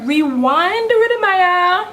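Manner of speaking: excited. Retold or imitated. Reacting to cheap prices.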